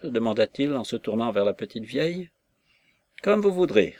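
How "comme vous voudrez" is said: higher, lively and quick; brisk reply. A narrator's reading. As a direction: toss the answer back eagerly. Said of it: "répondit-elle vivement".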